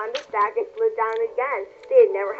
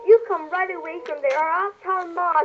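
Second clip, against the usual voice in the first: put-on higher scolding child voice; bossy older sister; 8 semitones higher than usual. A narrator's reading. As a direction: threaten to tell on her. Mary threatening to tell.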